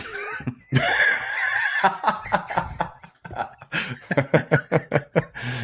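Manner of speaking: loud burst of laughter. Real, not acted. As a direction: howl with laughter. Big laugh at the story.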